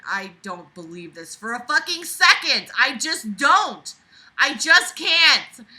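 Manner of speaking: loud, slow disbelieving yell; incredulous yelling. Real, not acted. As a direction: shout it like you can't take it anymore. Reader mocking the story's claim.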